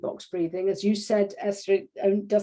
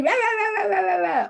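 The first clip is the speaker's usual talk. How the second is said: mock tantrum babble; 10 semitones higher than usual. Mock tantrum as example event.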